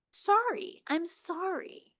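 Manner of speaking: soft, slow, sad "I'm sorry". Shown as a demonstration. Teacher models a sincere, sad apology. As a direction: say "Sorry" like you truly feel bad. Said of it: "Sorry should look like you're sad".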